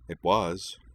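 ordinary delivery, nothing marked

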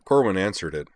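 usual speaking voice

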